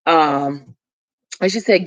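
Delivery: usual speaking voice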